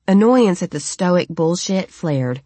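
plain reading voice

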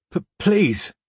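put-on high, whining plea like a frightened child; whiny child's voice. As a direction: beg in a high childish whine. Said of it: "pleaded in a whiny child's voice".

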